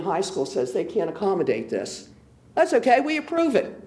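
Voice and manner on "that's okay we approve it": higher-pitched, dismissive imitation of officials; mock breezy approval. Resident mimics council ignoring the school's warning, mock breezy approval. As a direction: wave it through in a higher mocking voice.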